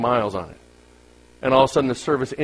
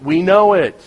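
emphatic; 7 semitones higher, 9 dB louder, slower than usual. Acted. Repeated, loud falling.

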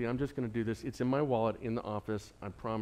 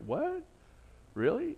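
surprised; 12 semitones higher, 3 dB quieter than usual. Retold or imitated. Retold surprise at award.

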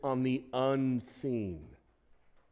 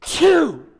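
sudden loud sneeze mid-sermon; a sharp burst of a sneeze; real sneeze; 13 semitones higher, 14 dB louder than usual. Real, not acted. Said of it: "I have never sneezed".

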